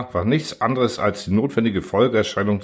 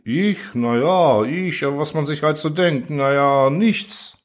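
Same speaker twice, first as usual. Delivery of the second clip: high, dopey officer voice, pitched up; dim officer; 6 semitones higher, slower than usual. Acted. Officers ask Kaczmarczyk what he thought, dopey high reply. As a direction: play the clueless officer shrugging it off.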